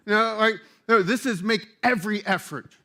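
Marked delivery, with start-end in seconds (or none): none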